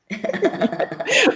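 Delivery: a high, bright laugh; high burst of laughter. Real, not acted. Guest laughs at her own age joke, high and bright.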